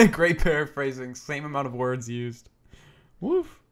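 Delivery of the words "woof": high rising bark; dog woof. Acted. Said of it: "Forgot I was a dog".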